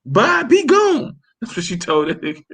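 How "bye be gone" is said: high, slow, mocking kid's voice; hammed-up little girl dismissal. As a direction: drag it out like a snotty little girl. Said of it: "and she's like".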